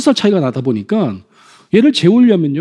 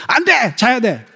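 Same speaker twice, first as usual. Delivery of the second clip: mock parent's final order; 3 semitones higher, slower than usual. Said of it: "이렇게 끝납니다".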